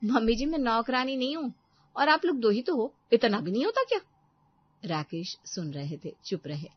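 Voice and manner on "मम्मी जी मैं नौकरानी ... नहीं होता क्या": put-on loud, higher, sneering young wife; haughty daughter-in-law. Daughter-in-law to mother-in-law, haughty and loud. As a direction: put the old woman in her place.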